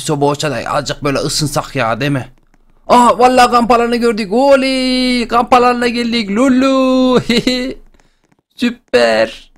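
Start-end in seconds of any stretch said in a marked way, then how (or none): 2.9-9.5 overjoyed shouting; joyful cheer. Real, not acted. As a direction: whoop with relief at reaching camp. Reaches the camp, cheering.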